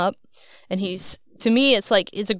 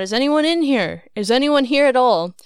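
raised, calling into a dark building; louder, higher search call; 4 semitones higher, 4 dB louder than usual. Retold or imitated. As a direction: call out loudly to anyone who might be there. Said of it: "I was calling out".

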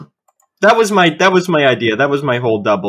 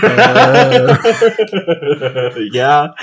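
laughing fit; 7 semitones higher, 3 dB louder than usual. Real, not acted. Laughing at accent talk.

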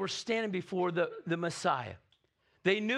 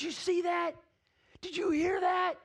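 amazed shepherds; 10 semitones higher than usual. Retold or imitated. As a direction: gasp it out like you can't believe your eyes. Shepherds amazed after the angels.

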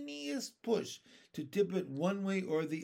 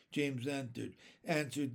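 plain reading voice

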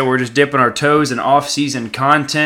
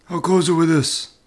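[name]; 5 semitones higher than usual. Pretend. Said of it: "[name]".

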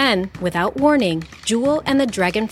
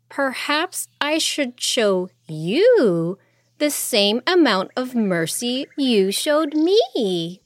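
slow and deliberate; 5 semitones higher, slower than usual. Acted. Princess weighs revenge.

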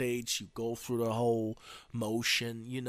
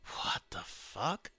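slow, whispered bewilderment; confused; 6 dB quieter, slower than usual. Acted. New president following the guards, slow confused whisper. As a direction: draw it out under your breath. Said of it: "now you're really fucking confused".

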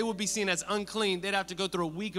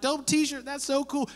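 bright, pitched-up friendly praise; preacher acting out greeting a stranger, pitched up; casual compliment; 7 semitones higher than usual. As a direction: hype up a stranger's shirt to start a chat.

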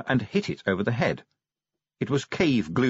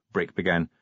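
usual speaking voice

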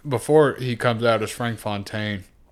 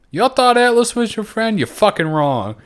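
high, loud gloating reveal, dropping at the end; hammed-up villain's gotcha; mock taunt; 11 semitones higher, 6 dB louder than usual. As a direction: taunt them with the betrayal. Voicing villain's taunting radio reveal.

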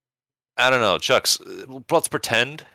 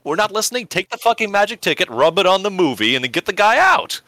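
high, loud exasperated rant; mock exasperation; 13 semitones higher, 4 dB louder than usual. Real, not acted. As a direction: berate your cohost for missing it.

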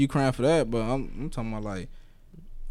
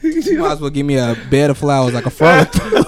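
louder, laughing through it; 6 dB louder than usual. Real, not acted. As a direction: crack up mid-sentence.

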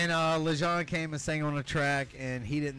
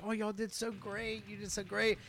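mock consolation; 7 semitones higher, 4 dB quieter than usual. People backstage consoling the band.

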